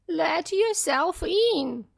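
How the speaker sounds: put-on high, slow old-lady voice; weak granny calling out; frail grandmother. A narrator's reading. Grandmother telling the visitor to come in, frail and slow. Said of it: "said the grandmother".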